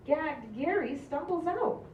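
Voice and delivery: usual speaking voice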